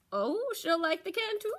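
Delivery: a chirpy stall keeper; Nanny Goat voice. Acted. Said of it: "said Miss N-, Nanny Goat told him".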